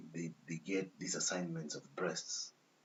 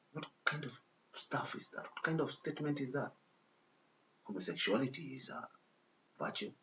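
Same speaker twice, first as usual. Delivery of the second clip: higher-pitched, scoffing questions; incredulous; 6 semitones higher than usual. Real, not acted. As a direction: question it in sheer disbelief. Scoffing at a reported claim.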